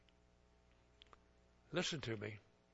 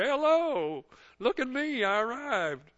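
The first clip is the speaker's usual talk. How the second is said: high, loud look-at-me voice; hammed-up boast of having arrived; mock show-off; 8 semitones higher, 7 dB louder, slower than usual. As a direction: preen like a showboat.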